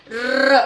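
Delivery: र as a long rolled "rrr"; vowelless r. Shown as a demonstration. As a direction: hold a tongue-tip trill on र. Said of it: "तो ये बन गया र".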